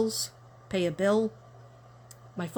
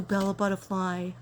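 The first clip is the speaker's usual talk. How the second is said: normal talking voice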